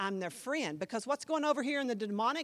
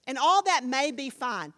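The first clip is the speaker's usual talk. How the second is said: normal talking voice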